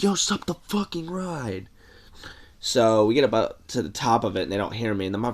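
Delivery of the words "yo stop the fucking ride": higher, urgent mock shout; friend yelling. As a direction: yell up at the operators to stop. Friend yells to stop ride.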